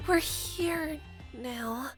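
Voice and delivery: slow, soft comfort; gentle reassurance. Acted. Comforting Cadance now help has arrived, slow and soft. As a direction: settle into a slow, hushed tone.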